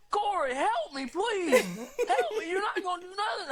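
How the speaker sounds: mock scream for help from the back seat; mock panicked pleading. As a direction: imitate a terrified plea for help, hammed-up.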